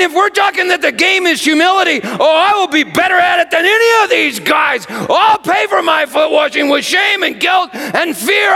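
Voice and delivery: high, loud pleading outburst; frantic Peter shout. Acted. Peter overdoing humility to Jesus, high frantic shout. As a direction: bargain desperately at the top of the voice.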